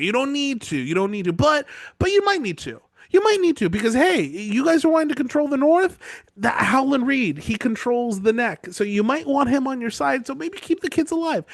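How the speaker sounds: put-on high, coaxing voice for a kid; sweet maester voice. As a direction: coax him gently like a teacher. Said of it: "He's like".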